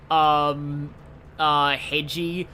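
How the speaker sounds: ordinary delivery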